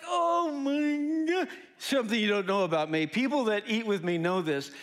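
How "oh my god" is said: high, slow food ecstasy; blissed-out rapture. Acted. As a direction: draw it out high, overcome by the taste. Raving over the tender steak.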